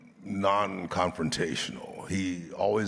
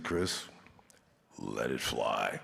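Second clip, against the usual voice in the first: low, soft, slow go-ahead; calm permission; 10 semitones lower, 9 dB quieter, slower than usual. Retold or imitated. Director calmly freeing his lead actor, low and soft. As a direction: hand it over gently, in no hurry. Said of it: "And he said".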